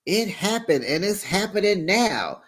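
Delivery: lightly voiced Tamara quote; imitating Tamara. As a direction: play Tamara setting the record straight. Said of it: "Tamara says".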